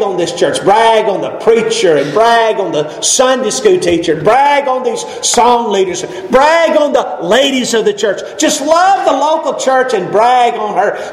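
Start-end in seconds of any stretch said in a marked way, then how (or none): none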